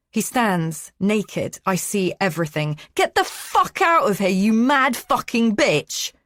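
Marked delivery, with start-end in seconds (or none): 2.9-6.1 high, loud furious shout, slowing at the end; enraged yelling. A narrator's reading. Man ordering the intruder out, enraged yelling. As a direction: scream her out of the room. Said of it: "He's enraged and a little scared too".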